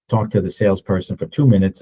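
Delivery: ordinary delivery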